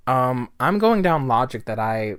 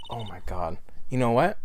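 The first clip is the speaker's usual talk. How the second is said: normal talking voice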